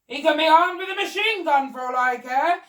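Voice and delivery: loud, high blustering bellow. Acted. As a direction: boom it out fearlessly. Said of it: "bellowed the Duke".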